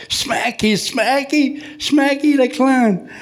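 high-pitched, delighted calling out; excited fan calling. Retold or imitated. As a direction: imitate a lady hailing a clown, drawing out the name. Lady calls out to the clown.

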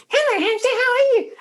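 very high, squeaky playback; tiny mimicking hamster voice; high-pitched toy hamster. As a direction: parrot it back in a squeaky toy voice. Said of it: "plays it back really high-pitched".

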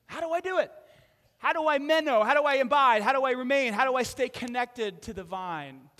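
loud, rising questions fading down; building preacher's questions. Real, not acted. Preacher pressing the congregation with loud questions. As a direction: build the questions up, then ease off.

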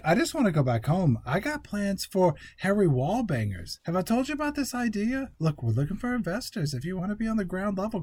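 wheedling bro impression. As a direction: pitch it like a hustling bro. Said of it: "[name] is like".

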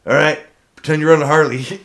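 mock drill instructor to a recruit. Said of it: "He's like".

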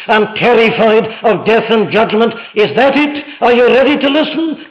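high, impassioned preacher's appeal; urgent preaching. Real, not acted. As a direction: press each question harder, building in pitch. Preacher presses questions on congregation.

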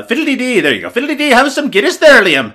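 high, loud hammed-up Irish lilt; mock Irish accent. Host teases straight-edge co-host in a hammed-up Irish accent. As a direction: ham up a stage Irish voice offering Guinness. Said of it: "that's not my Irish".

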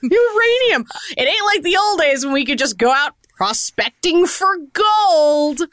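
hammed-up cranky old-timer griping; old prospector. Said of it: "where he's like".

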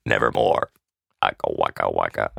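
very low croaking raven. Shown as a demonstration. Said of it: "That's the raven".

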